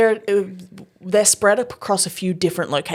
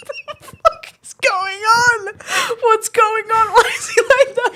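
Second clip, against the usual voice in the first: high, squealing talk through laughter; helpless laughter; slower than usual. Real, not acted. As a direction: barely get the words out for laughing. Cracking up over the story.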